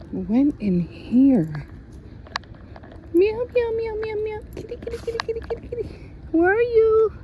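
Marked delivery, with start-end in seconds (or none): 3.1-7.1 high sing-song meows to coax a cat; meowing to a cat. Real, not acted. Vlogger calling a stray cat, squeaky high meowing.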